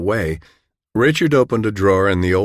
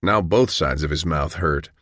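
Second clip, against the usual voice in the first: ordinary delivery, nothing marked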